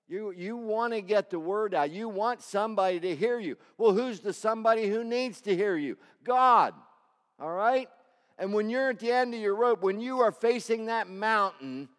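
loud, high preaching, slowing at the end; preaching at full force. Acted. Pastor urging church to cry out, loud preaching. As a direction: urge them to cry out to God.

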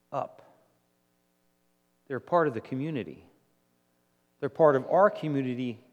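normal talking voice

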